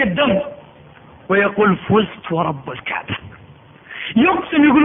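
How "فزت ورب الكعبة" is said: slow, soft dying vow; hushed triumph. Acted. Stabbed companion swears he has won, soft and slow. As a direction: let it fall quiet and slow like a last breath.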